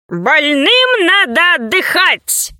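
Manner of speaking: doctor's character voice. A narrator's reading. Doctor says the sick must rest, slow character voice.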